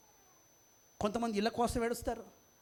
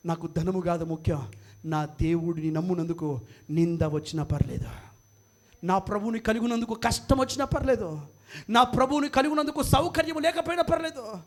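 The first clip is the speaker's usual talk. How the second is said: fervent preaching declaration; 4 semitones lower, 4 dB louder than usual. Acted. Preacher declares hardship is fine for the Lord's sake, fervent declaration. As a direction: swell line by line into full-force testimony.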